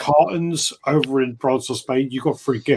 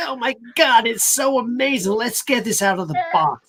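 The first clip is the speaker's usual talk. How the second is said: high-pitched gushing American geek; hammed-up American unboxing-host voice; bad geeky American accent; 10 semitones higher than usual. Shown as a demonstration. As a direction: gush in a terrible nasal American accent. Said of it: "like, bad American accent".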